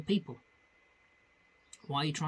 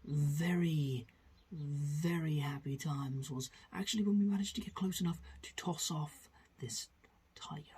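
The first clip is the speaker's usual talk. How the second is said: David Attenborough impression. Narrating to camera about tigers, in a hushed documentary whisper. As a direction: breathe it like a wildlife presenter stalking tigers. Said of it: "He was like".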